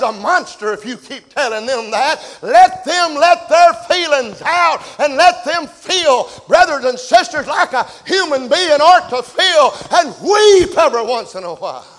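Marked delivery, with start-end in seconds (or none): 2.4-11.9 fervent shouted plea; preaching at full force. Real, not acted. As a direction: plead with the men, full voice.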